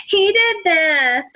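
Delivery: put-on high, slow childish whine; mock whining child telling on a sibling; whiny kid. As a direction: whine it like a tattling little kid. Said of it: "they gotta have the whine to it".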